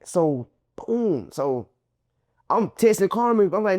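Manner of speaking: ordinary talk, nothing marked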